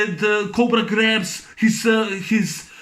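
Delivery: ordinary talk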